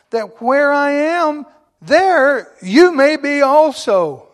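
high, loud and slow; swelling pulpit cry; preaching climax. Acted. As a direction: soar up on the promise. Preacher lifts scripture to climax.

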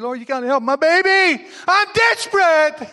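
high, loud pleading cry; desperate plea. Acted. A mother pleading with Jesus for her sick daughter, desperate high cry. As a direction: beg like a mother whose child is dying.

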